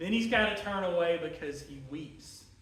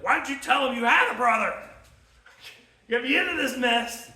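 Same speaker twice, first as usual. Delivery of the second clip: loud, higher, quick exasperated outburst; acted scolding; 4 semitones higher, 8 dB louder than usual. Dad blaming his sons over Benjamin, acted scolding. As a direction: play an angry father telling them off.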